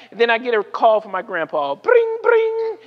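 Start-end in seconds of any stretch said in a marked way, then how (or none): 1.7-2.8 high trilled telephone ring; vocal ringtone, pitched way up; imitated phone ring. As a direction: mimic an old phone ringing.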